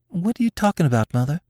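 put-on high-pitched girl's voice, calling; high, light daughter's query. A narrator's reading. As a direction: call to mother in a high questioning voice. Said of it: "called Nanny".

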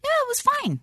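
very high, soft, unconvincing "fine"; mock faint praise, squeaked quietly; voice going up. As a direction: lift "fine" way up, small and noncommittal. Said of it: "when your voice goes up".

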